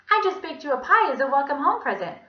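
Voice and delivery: high, loud lady's singsong; cheery Miss Mush. A narrator's reading. Visitor calling through the classroom door, cheery high voice.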